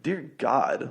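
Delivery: ordinary talk, nothing marked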